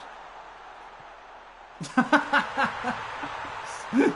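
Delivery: long high breathless laugh; breathless laughter. Real, not acted. Laughing at his own clumsy wording, breathless and high. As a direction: wheeze with laughter at your own slip.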